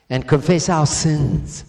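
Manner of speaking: usual speaking voice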